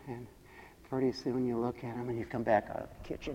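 ordinary talk, nothing marked

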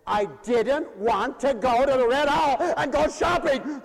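loud, high, deliberate griping; hammed-up grumbling at full voice; retold fed-up complaint. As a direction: punch out each word, exasperated. Preacher retells being fed up.

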